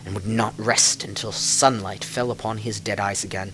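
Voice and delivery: measured storyteller telling a dark fairy tale; story narration. A narrator's reading. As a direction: draw listeners into a legend. Reads a fairy-tale style tale.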